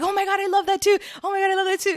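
high, gushing mock coworkers. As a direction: squeal it like excited office chatter. Coworkers gushing over cheese.

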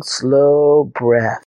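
plain reading voice

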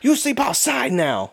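high, slow mock French scolding; French kid banishing the drunk buddy outside; angry French kid. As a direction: lay down the law like the French kid. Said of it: "He goes".

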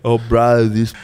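exaggerated Eshay "bro" accent; Eshay lad accent. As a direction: imitate an Eshay street lad. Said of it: "It wasn't this, like".

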